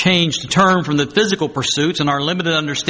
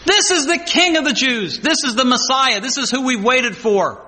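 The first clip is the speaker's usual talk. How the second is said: higher-pitched voice of an eager crowd; acclaiming crowd; 7 semitones higher than usual. Acted. People greeting their Messiah at the triumphal entry, high acclaim. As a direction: announce it high, line after line. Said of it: "the people are saying".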